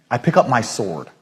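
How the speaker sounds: ordinary talk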